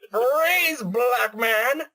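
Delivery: very slow, high, hammy villain cry; Skeletor voice. Retold or imitated. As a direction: drag it out like a cartoon villain. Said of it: "I went".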